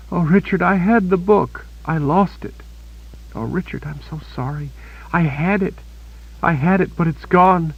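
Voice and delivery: wounded Kaelin apologizing to Richard for losing the book; distraught young woman. A narrator's reading. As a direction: confess in despair, near tears.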